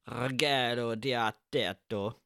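slow, drawn-out Italian-style name; attempted Italian accent. Host naming the film's director, attempted Italian accent. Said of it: "no idea what accent that was".

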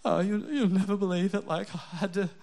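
husband whining to his wife about a tire, mock-pathetic; self-mocking whine to wife. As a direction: whine like a man who just changed his first tire. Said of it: "sometimes it's just like".

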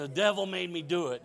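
light comic catchphrase impression; loud, playful excuse in a mock character voice; Geraldine. Preacher recalls Flip Wilson's character, playful imitation. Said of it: "He had a character, you know, Geraldine".

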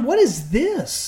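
high, slow disbelieving rant; incredulous. Retold or imitated. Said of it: "I remember watching that mugging and being like".

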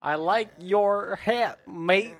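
put-on slow, high Australian accent; a hammy Australian accent.

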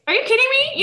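high-pitched retold disbelief, half laughing; incredulous. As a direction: re-enact an indignant question with laughter in it. Retold reply to screaming wife.

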